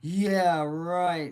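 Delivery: mock scornful teacher brushing it off; scoffing teacher. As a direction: scoff at the idea. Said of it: "And he laughed".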